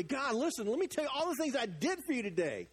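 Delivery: high, eager mock boasting; hammed-up, insistent appeal for credit; self-important pitch to God. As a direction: imitate someone reporting good deeds to God. Acts people boasting to God.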